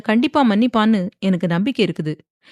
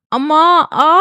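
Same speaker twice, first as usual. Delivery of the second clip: sharp high cry of pain, much louder; pained scream; 9 semitones higher, 7 dB louder than usual. A narrator's reading. Wife screaming out in pain as husband hurts her. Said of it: "என்று அலறிய வந்தனாவின்".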